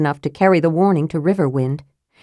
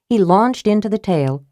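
ordinary delivery, nothing marked

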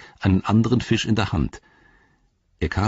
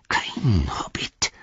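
whispering creature; slower than usual. A narrator's reading. Gollum whispering suspicion.

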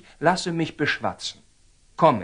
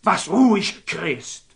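indignant retort; 4 semitones higher, slower than usual. Acted. Templar bristles at Saladin's rebuke.

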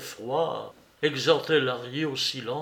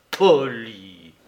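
loud, low, drawn-out word; scornful echo; 4 semitones lower, 7 dB louder than usual. A narrator's reading. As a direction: repeat it lower, heavy with scorn. Repeated echo, lower and drawn out.

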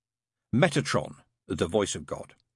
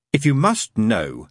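a trifle testy; 2 semitones higher, 7 dB louder than usual. Acted. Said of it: "a trifle testily".

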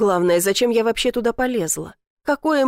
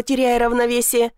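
ordinary delivery

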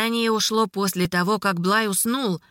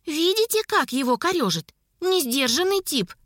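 put-on high girlish aside; 6 semitones higher than usual. A narrator's reading. Элайна comments aside on the man.

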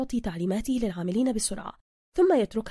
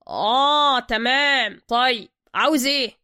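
gruff master mechanic; 3 semitones higher, 7 dB louder, slower than usual. Acted. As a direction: greet him like you own the place. Zergina asks.

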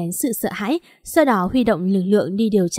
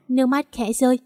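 usual speaking voice

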